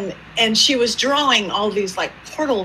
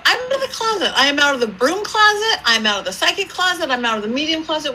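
high-pitched, repeated declaration; mock exultant announcement; 6 semitones higher, faster than usual. Medium coming out to her client, mock exultant.

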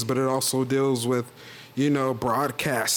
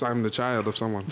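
ordinary talk

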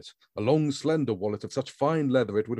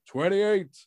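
much higher, dramatic intoning; dramatic countdown; 10 semitones higher, 3 dB louder, slower than usual. A narrator's reading. Grand counting down at the man, dramatic and high. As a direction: intone it like through a megaphone. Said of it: "began dramatically intoning".

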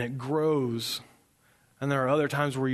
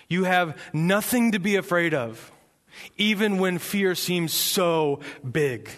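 raised, emphatic sermon delivery; impassioned preaching; 5 semitones higher, 4 dB louder than usual. Acted. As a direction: drive each assurance home with conviction. Preaches forcefully in God's voice.

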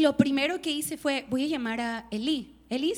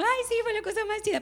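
breezy, making light of it; 7 semitones higher than usual. Retold or imitated. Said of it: "ya las conté así como".

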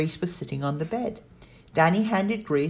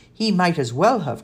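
loud, insistent comeback; countering; 4 semitones higher, 7 dB louder than usual. A narrator's reading. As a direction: push back firmly, voice raised. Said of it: "countered Kat".

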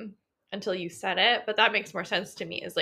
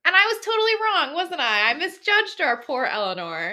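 playful; 8 semitones higher, 5 dB louder than usual. Real, not acted. Playful self-correction.

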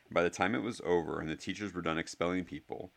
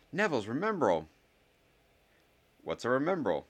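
pitched up, schoolboy dialogue; higher-pitched, curious exchange; higher boys' voices; 7 semitones higher than usual. A narrator's reading. Boys exchanging talk over a found ball, higher-pitched.

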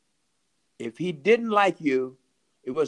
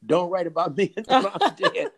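louder, higher, breaking into a laugh; laughing quote; 4 semitones higher, 6 dB louder than usual. Retold or imitated. Writer repeating his friend's condition, laughing. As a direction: repeat it with open amusement.

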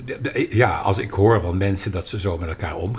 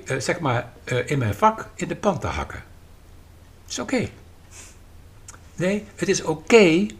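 usual speaking voice

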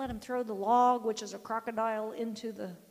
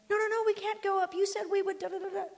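put-on high, louder whiny kid protest; whining child; 9 semitones higher, 4 dB louder, faster than usual. Grandson refusing the hill, mock whine. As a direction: whine it like a kid throwing a fit. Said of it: "He's screaming. He's crying".

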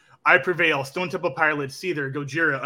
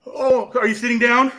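high and loud with barely held glee; about to burst with good news; excited; 8 semitones higher, 7 dB louder than usual. Real, not acted. About to share big news.

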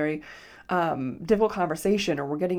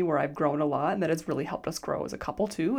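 ordinary talk, nothing marked

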